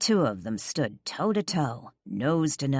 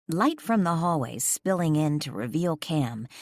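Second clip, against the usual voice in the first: ordinary delivery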